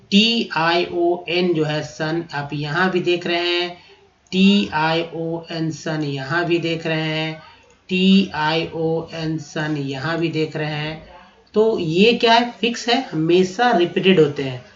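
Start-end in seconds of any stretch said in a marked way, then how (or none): 0.1-2.2 T I O N spelled letter by letter, slowly, then सन; slow spelling out. Shown as a demonstration. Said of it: "मैं आपको बताता हूँ कि सन". 4.3-11.1 T I O N spelled slowly, letter by letter, then सन; slow spelling out. Shown as a demonstration. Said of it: "मैं आपको बताता हूँ कि सन".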